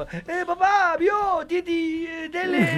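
put-on high, loud toddler babble; exaggerated high child voice; little daughter's voice. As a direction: do an excited small child, words stretched. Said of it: "¿Sos de hacerle voces?".

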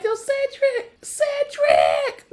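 high, hammed-up shrieked name-calling; going crazy. Steve calling out for Cedric, going crazy in mock shriek. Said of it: "Steve is just going crazy".

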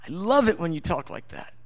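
a hint of a delighted father; voicing God. Preacher voicing God to a praying child, tender and low. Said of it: "He goes".

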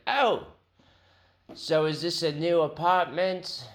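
surprised British phone-agent imitation; British accent. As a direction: ask it in a put-on British accent. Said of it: "He was British".

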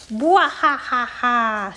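slow, hammed-up villain cackle; evil supervillain laugh. Captain Plaque laughing at the class, slow evil cackle. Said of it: "in an evil supervillain voice".

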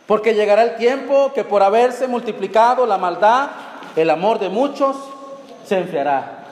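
loud, rising sermon voice; fiery preaching. Acted. Preacher proclaiming scripture to the congregation, loud and fervent. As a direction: preach it hard to the church.

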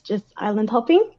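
usual speaking voice

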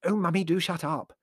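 high, exasperated hiss; mildly hissed telling-off; hissed rebuke. A narrator's reading. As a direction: shush your mother crossly. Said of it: "hissed Prue".

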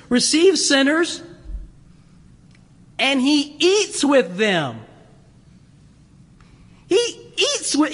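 high-pitched, scandalized mock complaint; indignant grumbling. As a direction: act out Pharisees appalled, drawing out the words. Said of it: "They grumbled, saying".

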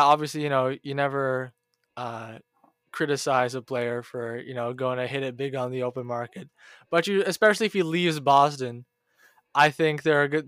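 ordinary delivery

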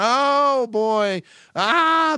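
cracking up at the sight; hammed-up family howl; mock laughing family.

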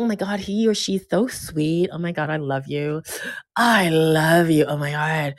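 soft, breathless swooning; lovestruck gushing. Retold or imitated. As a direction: swoon softly over a new crush. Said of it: "you're like".

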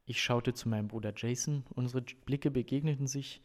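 animated audiobook reading with lively dialogue; story narration. A narrator's reading. Reader performs coach's visit to the family, story narration. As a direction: read out the scene with emphatic dialogue. Reads novel passage aloud.

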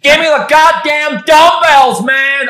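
loud, high mock-American yell; mock outburst at full volume; hammed-up actor shout. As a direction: bellow it like an exaggerated TV tough guy. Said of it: "talk to me like the guy from Veep".

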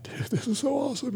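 high, breathy amazed; awestruck. Retold or imitated. Retelling hearing God's laws, high awestruck delight. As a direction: let it break high in wonder. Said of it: "And I was like".

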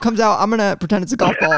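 ordinary delivery

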